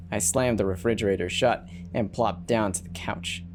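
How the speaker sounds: ordinary delivery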